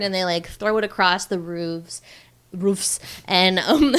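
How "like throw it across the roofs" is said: ordinary delivery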